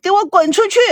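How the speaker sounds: high, explosive dismissal; enraged. A narrator's reading. Daughter further provoked by the visitor, enraged. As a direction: order him out, even angrier for being questioned. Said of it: "更是火上浇油".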